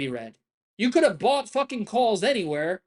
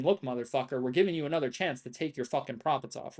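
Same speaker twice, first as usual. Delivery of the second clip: voicing the market; 7 semitones lower, 5 dB quieter than usual. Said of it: "The market's literally saying".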